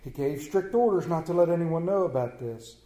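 ordinary talk

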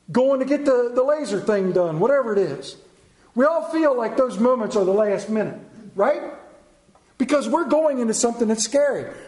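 ordinary talk, nothing marked

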